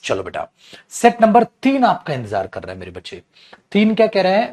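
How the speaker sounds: usual speaking voice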